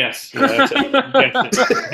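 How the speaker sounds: high, laughing mock agreement; sarcastic yes. Host sarcastically agrees with a silly guess, laughing. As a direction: gush fake agreement through laughter.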